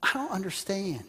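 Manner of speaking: high, pleading prayer to God; Abraham praying. Retold or imitated. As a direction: plead with God, confused and anxious. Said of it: "and said".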